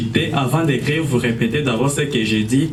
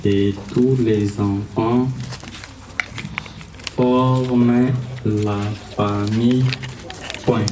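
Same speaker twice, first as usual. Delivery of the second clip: slow dictation, word by word; dictating; 2 semitones lower, slower than usual. Shown as a demonstration. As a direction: spell it out slowly for writing. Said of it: "Écrivez".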